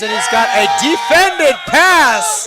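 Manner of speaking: high, loud and slow on the big play; excited play-by-play. Real, not acted. Commentator calling a defended pass excitedly. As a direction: call the defended pass like it matters.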